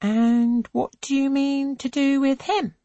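high, keen question; eager question. A narrator's reading. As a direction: lean in curious. Angelica eagerly asks.

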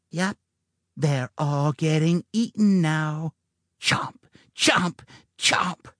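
high gloating whispered chomps; cackling. A narrator's reading. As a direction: cackle it with a creepy hiss. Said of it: "Eve cackled".

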